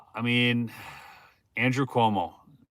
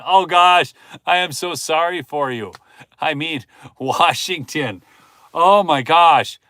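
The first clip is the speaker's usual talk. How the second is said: loud, higher, exaggerated sympathy, slowing at the end; mock pity; 4 semitones higher, 7 dB louder, slower than usual. Real, not acted. A streamer pities Washington viewers in loud mock sympathy. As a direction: pity them with a groan. Dismay at Washington mail-in voting.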